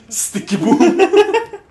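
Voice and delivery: very high, slow, laughing; high squealed sticky boom. Retold or imitated. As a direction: stretch it out, squealing. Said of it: "sound like a sticky boom".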